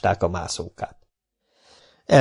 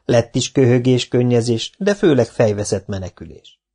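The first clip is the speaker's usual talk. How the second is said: ordinary delivery, nothing marked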